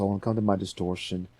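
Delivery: usual speaking voice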